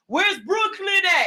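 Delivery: high, stretched call to the crowd; mock crowd call-out, pitched high; imitated club yell. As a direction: holler it like a club DJ. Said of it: "somebody gonna yell out".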